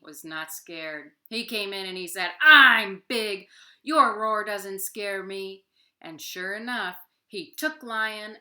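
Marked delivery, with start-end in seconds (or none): 2.4-5.6 loud, slow unimpressed giant; scornful monster boast. Acted. Vinganani mocking Lion's roar, loud slow monster. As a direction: sneer down at the little roar.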